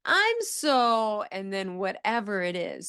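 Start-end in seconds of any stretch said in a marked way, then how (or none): 0.1-1.2 "I'm so" pitched high and overplayed; exaggerated feeling. Shown as a demonstration. Therapist modeling feelings to a child, overplayed. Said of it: "I like to make it exaggerated".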